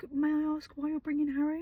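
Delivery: high, polite mock receptionist. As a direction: put on a prim, polite front-desk voice. Receptionist voiced in retelling.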